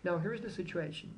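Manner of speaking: normal talking voice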